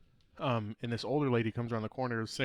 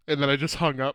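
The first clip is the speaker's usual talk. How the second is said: laughing loud; 4 semitones higher, 10 dB louder than usual. Real, not acted. Laughing retelling a panic hang-up.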